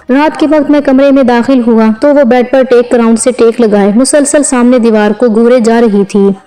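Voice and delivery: ordinary delivery, nothing marked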